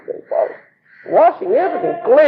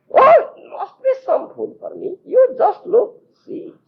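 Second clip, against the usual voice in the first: coaxing; 11 semitones higher than usual. Retold or imitated. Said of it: "Krishna insisting there's food".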